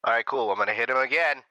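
ordinary delivery

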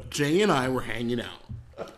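low storyteller voice without the Mexican accent; Mexican accent dropped. Real, not acted. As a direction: set the scene, Mexican accent gone. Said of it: "all of your Mexican accent has dropped away".

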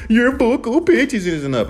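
high-pitched complaining imitation; mock critic. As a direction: mimic a nitpicking critic, voice pushed up. Mimics critics' complaint about pitch.

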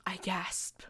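whispered, breath-caught gasp; mock gasp. A narrator's reading. Acting out the gasp.

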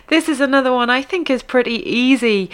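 normal talking voice

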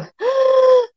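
high, drawn-out choking inhale; one long wheezing pull of breath; gasping for air. Retold or imitated. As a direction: act out air refusing to return. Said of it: "o ar não voltava".

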